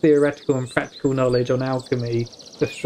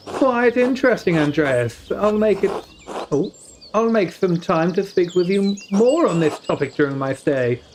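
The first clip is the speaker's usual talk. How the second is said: lightly raised noble lord; 5 semitones higher than usual. Acted. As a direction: purr it like a curious lord. Voicing the lord character.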